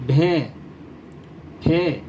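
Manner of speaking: بھے and پھے read slowly, each with a clear puff of breath; aspirated letter demo. Shown as a demonstration. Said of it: "جیسے میں آپ کے سامنے پڑھ کر پیش کرتا ہوں". (حروف کو ہوا کے جھٹکے کے ساتھ صاف ادا کرنا)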